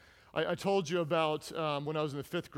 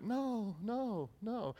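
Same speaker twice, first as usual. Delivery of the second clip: put-on sheepish kid; 6 semitones higher, 5 dB quieter, much slower than usual.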